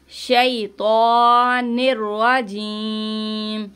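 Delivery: शैतानिर रजीम chanted very slowly, with the long vowel stretched before the stop; drawn-out tajweed recitation. Shown as a demonstration. Teacher demonstrating the stretch in रजीम. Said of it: "या मद्दा है, इसको खींचते हुए रुकेंगे".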